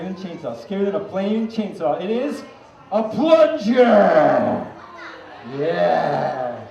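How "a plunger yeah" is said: triumphant reveal. Acted. Big comic reveal to the crowd.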